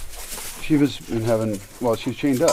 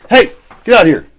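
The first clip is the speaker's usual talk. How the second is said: shouting at dog; 9 dB louder than usual. Real, not acted. Owner yelling at the dog to get out, loud.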